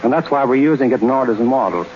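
ordinary talk

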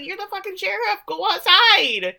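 incredulous viewer ordering a character about; exasperated scolding. Real, not acted. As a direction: yell at the screen in high-pitched disbelief. Acted exasperated yelling at the sheriff.

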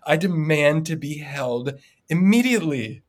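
put-on slow, high and insistent little-girl demand; bossy little girl. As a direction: insist like a toddler who expects to be obeyed. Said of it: "that intent and that aggressive".

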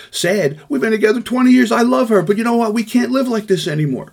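a husband in crisis telling the negotiator his marriage is ending; distraught husband. Acted. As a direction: let the hurt spill out, pitch climbing. Distraught man pours out marriage.

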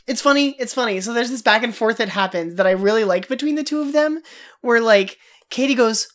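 ordinary talk

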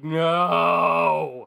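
loud anguished cry of no; despairing shout. Retold or imitated. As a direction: react in mock horror. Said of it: "And we were just like".

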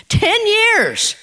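ten years jumps up high, ringing out; ringing repeat. Shown as a demonstration. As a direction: throw TEN YEARS up high as an astonished echo. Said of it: "long as ten years".

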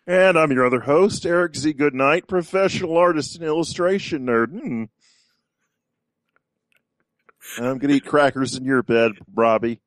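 high-pitched, forceful Muppet character. Acted. Co-host catching the host off guard, Muppet voice. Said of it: "he can do a good Muppet voice".

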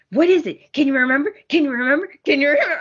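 lightly voiced pushy questioning; pushy Ridge. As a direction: imitate Ridge nagging her to remember. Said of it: "He's like, all on top of her".

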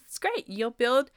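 high-pitched pep-talk imitation; mother-in-law. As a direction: voice a chirpy mother-in-law selling the hardship. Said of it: "mother-in-law used to tell us".

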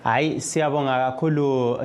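ordinary delivery

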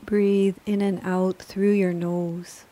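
calm, measured yoga nidra guidance; unhurried, even-toned relaxation voice; guided meditation. Acted. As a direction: guide a resting listener gently, with long pauses between phrases.

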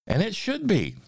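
triumphant storybook ending, high then settling; story narration. A narrator's reading. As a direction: start high and jubilant, ease into the close. Reads a rhyming story aloud.

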